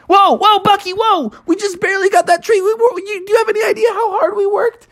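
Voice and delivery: very high, fast panicked protest; frogs protesting. Acted. Frog family pleading with Bucky to stop chewing, very high. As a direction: stop the beaver, quickly.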